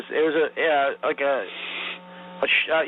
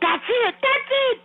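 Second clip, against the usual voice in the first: high, rising shout of approval; excited director. Retold or imitated. Young director thrilled by the take, high excited shout. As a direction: yell it like the take finally worked. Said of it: "The kid Eric goes".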